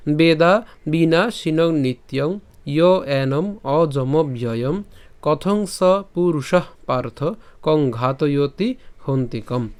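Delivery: slow, even Sanskrit recitation; verse recitation. Shown as a demonstration. Teacher repeats the verse from the start, slow recitation. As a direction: go through the whole shloka unhurriedly, word by word. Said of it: "প্রথম থেকে যদি আবার উচ্চারণ করে বলি".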